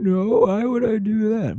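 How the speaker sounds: put-on very high, whiny kid wail; hammed-up tearful protest; mock wailing. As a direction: imitate a child bawling the answer. Retold crying kid answers dad.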